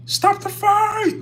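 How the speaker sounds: very high, drawn-out cry; mock yelled game voice. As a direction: imitate the game's high, slow yell. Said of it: "somebody's yelling".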